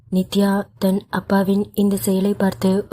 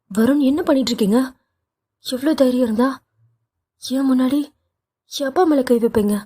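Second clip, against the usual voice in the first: higher, fast angry outburst; furious shout; 5 semitones higher, faster than usual. Acted. Wife stopping husband from hitting her father, angry higher shout. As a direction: shout him down in outrage, voice pitched up. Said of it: "Nithya ஆவேசமாக கத்திக்கொண்டு".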